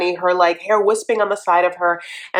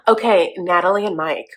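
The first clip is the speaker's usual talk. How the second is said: usual speaking voice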